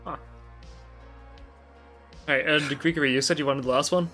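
usual speaking voice

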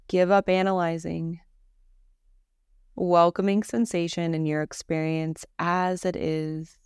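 calm, unhurried Yoga Nidra guide; guided meditation. Acted. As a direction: guide them gently into rest, with long pauses between phrases.

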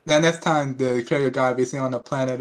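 ordinary delivery

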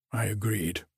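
usual speaking voice

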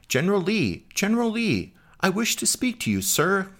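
very high, put-on woman's voice; frantic woman shouting. A narrator's reading. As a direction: call out frantically in falsetto, without real volume. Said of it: "and began shouting".

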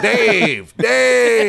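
put-on loud, high yelling old man; screaming old Polish man. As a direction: yell the name like an angry old man. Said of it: "old screaming Polish men".

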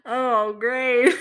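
lightly voiced sarcastic husband; mock husband sarcasm. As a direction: tease his weary reaction. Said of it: "He was like".

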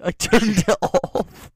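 loud, high, drawn-out mimicry; jeering drawn-out imitation; mocking echo. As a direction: throw the excuse back, stretched and loud. Mocks the co-host's excuse.

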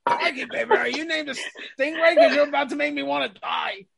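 high, hammed-up Aussie passion; mock Australian lover. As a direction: ham up an Australian climax. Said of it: "best Australian climaxes of".

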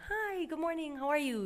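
bright customer-service hello; fake-polite greeting. Shown as a demonstration. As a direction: switch on the polite office voice. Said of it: "You can turn that on".

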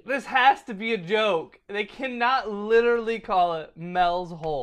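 high, loud, emphatic incredulity; loud disbelief. Real, not acted. Objecting to the name Mel's Hole, real loud disbelief. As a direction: protest as if it cannot be true.

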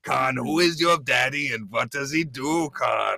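hammed-up Austrian accent; Arnold Schwarzenegger. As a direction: bellow it in a mock Austrian action-hero accent. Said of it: "Arnold Schwarzenegger".

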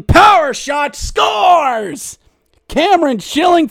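high shouted goal call; announcer yelling a power play goal. Real, not acted. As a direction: shout scores with full joy.

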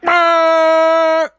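sudden high loud frantic "Ah!"; impatient honking yell. Retold or imitated. Drivers at a green light freaking out, high loud yelp. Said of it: "people are like".

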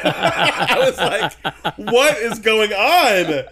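very slow, high and loud through laughter; cracking up in disbelief; laughing disbelief. Real, not acted. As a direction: laugh out the question.

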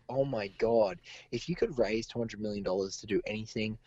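normal talking voice

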